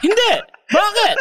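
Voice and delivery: mock innocent reply, cracking up; laughing. Answering the agents about sunglasses, laughing.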